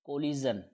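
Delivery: कोलिजन with a buzzing z in the middle: ko-li-zun; z sound. Shown as a demonstration. Teacher demonstrating the word to students, z sound in कोलिजन. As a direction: give कोलिजन a clear z, not sh. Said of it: "अब मैंने यहाँ पे ज बोला".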